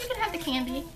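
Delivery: usual speaking voice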